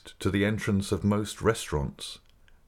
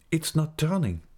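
high-pitched, impatient complaint; 9 semitones higher than usual. A narrator's reading. Raoul to the Persian as the mirror stays shut, impatient. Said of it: "said Raoul impatiently".

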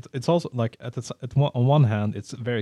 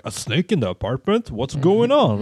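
rising, louder mock panic; hammed-up shock, higher and louder; mock alarm; 5 semitones higher, 5 dB louder than usual. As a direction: play the hysterical character. Mock panicked movie character.

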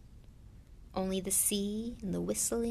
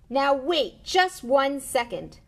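loud, slow, higher outburst; thundering; 6 semitones higher, 8 dB louder, much slower than usual. A narrator's reading. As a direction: thunder it out slowly, word by word. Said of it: "Hagrid thundered".

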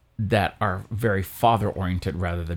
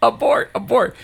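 high, louder playful alarm; mock alarm; 12 semitones higher, 4 dB louder than usual. Teller echoing the joke about fleeing, mock alarm. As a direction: call it like a fake emergency.

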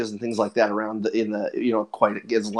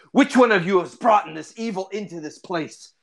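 raised-pitch dramatic indignation; solemn accusation, pitched up and emphatic; stern, accusing ranger; 6 semitones higher than usual. Acted. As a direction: confront them like an outraged fantasy ranger. Game master voices the angry ranger.

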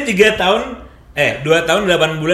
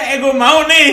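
high playful quote; 12 semitones higher, 3 dB louder than usual. Retold or imitated. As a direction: quote said high and bright with a laugh. Acting out a blunt offer.

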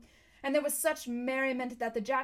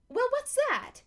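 very high, loud animal voice; baffled creature; 13 semitones higher, 7 dB louder than usual. A narrator's reading. One beast asking another about the strange word, shrieky bafflement. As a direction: squawk the question, utterly lost.

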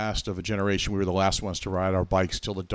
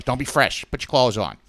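put-on Boston accent, louder motherly scolding; Boston mom scolding; 3 semitones higher, 5 dB louder than usual. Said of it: "that's what my mom talks".

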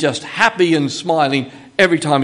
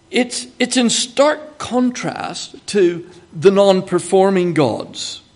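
normal talking voice

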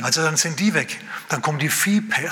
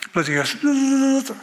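high, slow imitated whine of a mosquito; mosquito whine imitation; 10 semitones higher, slower than usual. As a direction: mimic a mosquito buzzing at your ear. Mosquito buzzing at night.

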